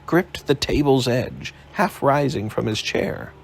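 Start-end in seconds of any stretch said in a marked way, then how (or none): none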